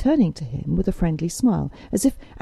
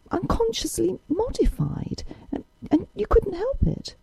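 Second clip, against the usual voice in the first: put-on young woman's earnest plea, higher voice; a proud girl pleading her point, lightly voiced higher; Isabel, earnest young woman; 10 semitones higher than usual. A narrator's reading.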